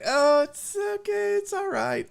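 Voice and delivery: put-on calm the drunk down kindly; patient reassurance. Patient couple reassures the annoying drunk, high and slow.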